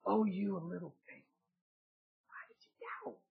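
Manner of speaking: soft, low, tender correction; gentle rebuke. Retold or imitated. Jesus lifting Peter, softly and tenderly correcting him. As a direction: say it kindly like a loving father. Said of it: "I believe he said".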